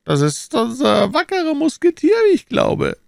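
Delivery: put-on high, strained old cardinal. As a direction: raise the voice into the cardinal's pitch. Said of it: "sagte der Kardinal".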